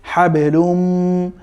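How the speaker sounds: হ্যাবলুম slowly, humming the final m through the nose; with ghunnah. Shown as a demonstration. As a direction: hold the nasal m at the end of হ্যাবলুম. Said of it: "গুন্নার সাথে পড়তে হবে".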